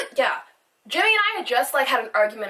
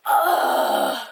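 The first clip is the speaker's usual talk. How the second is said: frustrated groan; 4 semitones higher than usual. Real, not acted. Frustrated exhale.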